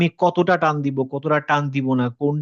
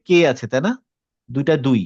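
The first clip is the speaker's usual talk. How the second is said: ordinary talk, nothing marked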